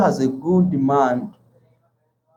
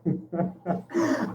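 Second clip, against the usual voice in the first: soft laugh; 8 dB quieter than usual. Real, not acted. Laughing at the friend's greeting.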